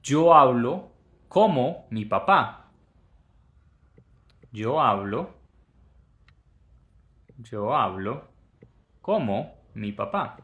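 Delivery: slow, deliberate "Yo hablo como mi papá"; slow example sentence. Shown as a demonstration. Teacher giving a Spanish example slowly. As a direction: read it out clearly. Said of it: "podría decir en español".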